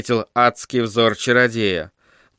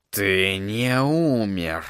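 low, very slow sorcerer voice; медленно; 4 semitones lower, 6 dB quieter, much slower than usual. A narrator's reading. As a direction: drag each word out menacingly. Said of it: "медленно произнёс чародей". (медленно, низким голосом)

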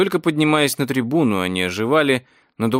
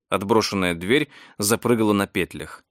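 usual speaking voice

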